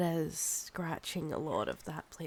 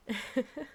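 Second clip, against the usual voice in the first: teasing laugh; 6 semitones higher than usual. Acted. Vampire teasing the captive.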